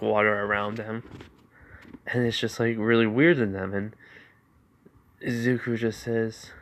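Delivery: usual speaking voice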